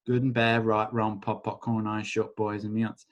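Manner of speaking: tossed-off chant, dropping away; quick mumbled incantation. Acted. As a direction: rote clearing phrase, murmured. Recites clearing statement.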